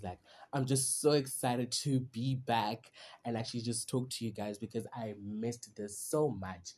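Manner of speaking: ordinary talk, nothing marked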